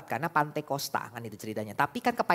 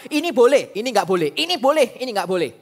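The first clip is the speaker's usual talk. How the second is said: mock rule listing; 6 semitones higher, 11 dB louder than usual. Listing rules mockingly.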